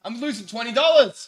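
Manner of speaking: high, loud exclamation; excited outcry. Real, not acted. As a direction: cry out at a bet going wrong. Bettor reacts to a knockdown.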